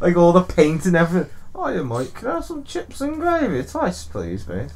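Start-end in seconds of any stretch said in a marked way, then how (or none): 1.5-4.7 put-on soft comic Brummie accent, swooping high; lilting, badly faked Midlands accent; mock Brummie accent. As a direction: put on a Brummie chip-shop order. Replays the running accent joke.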